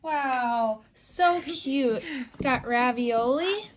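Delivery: usual speaking voice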